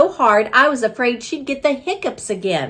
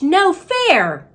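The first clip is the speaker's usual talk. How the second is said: high whiny no fair; indignant protest; 8 semitones higher than usual. Acted. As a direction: complain like a wronged kid. Pupil protesting.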